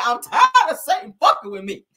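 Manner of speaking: loud, high imitation of a fed-up testifier; mock testifying. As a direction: imitate a man crying out his testimony. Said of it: "He got up there testifying".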